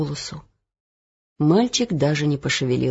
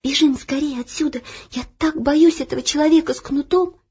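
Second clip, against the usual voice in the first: frightened; 8 semitones higher than usual. Acted. Frightened girl urges flight.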